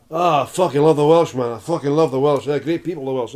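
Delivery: put-on hearty old Scottish barfly; Scottish accent. Old Scot at the bar praising Welsh visitors, hearty Scottish accent. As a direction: gush like a Scottish pub regular. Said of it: "this old Scottish guy at the bar".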